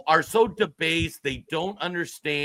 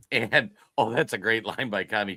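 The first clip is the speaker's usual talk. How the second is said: ordinary talk, nothing marked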